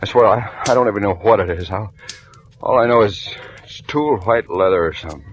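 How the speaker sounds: frightened insisting. Acted. Harry swearing to Marla he doesn't know, panicked stammer. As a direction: swear it desperately, tripping over words.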